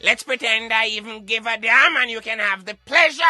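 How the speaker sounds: usual speaking voice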